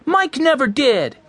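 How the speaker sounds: high, slow, emphatic shout; defensive shout. A narrator's reading. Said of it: "shouted Benny".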